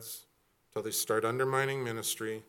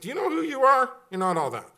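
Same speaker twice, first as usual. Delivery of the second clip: mock scolding; 12 semitones higher, 8 dB louder, faster than usual. Scolding the proud.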